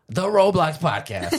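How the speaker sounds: loud mock-grand announcement; punched-out proud proclamation; big reveal. Mock big reveal of new name.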